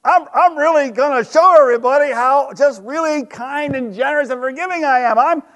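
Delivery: high, self-satisfied proud voice; smug Peter. Retold or imitated. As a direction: puff up, showing off your generosity. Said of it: "And Peter thought".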